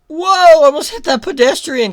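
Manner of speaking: loud high goofy exclamation; mock startled driver; goofy surprised whoa. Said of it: "Leonardo sounds like".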